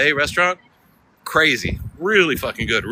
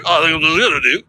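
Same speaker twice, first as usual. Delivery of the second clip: garbled Northern British mumble, higher pitched; mock Northern British accent; 6 semitones higher, 4 dB louder, faster than usual. Cab driver giving directions to American passenger, garbled British accent. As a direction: imitate an unintelligible British cabbie accent. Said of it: "I couldn't understand what he was saying".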